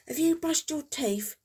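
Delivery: high, pushy mum asking about teeth; mum's nagging question. Retold or imitated. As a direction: put on a lightly voiced mum checking up. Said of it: "Mum said".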